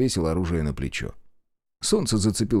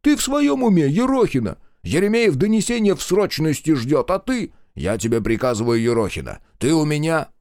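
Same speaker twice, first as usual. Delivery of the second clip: a high, loud outraged dressing-down; angry scolding; 7 semitones higher, 4 dB louder than usual. A narrator's reading. The commander scolds his scout for ignoring orders, outraged. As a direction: tear into her, losing patience.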